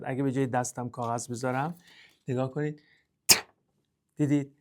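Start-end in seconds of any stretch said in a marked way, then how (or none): 3.3-3.3 ت as a bare burst of air; sharp, airy ت release; aspirated T. Shown as a demonstration. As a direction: puff out ت without a vowel.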